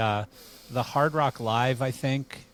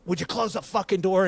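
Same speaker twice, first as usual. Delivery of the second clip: angry; 7 semitones higher than usual. Retold or imitated. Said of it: "he goes".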